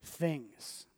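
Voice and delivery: soft, low, gentle correction; calm redirection. Acted. Jesus calmly redirecting Martha, soft and unhurried. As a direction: settle her down quietly. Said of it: "calmly redirects her".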